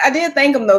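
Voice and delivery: usual speaking voice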